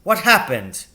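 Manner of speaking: loud, high demand; angry father. A narrator's reading. Furious father demands whether Captain Lancaster did it, loud. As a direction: press him hard, angry.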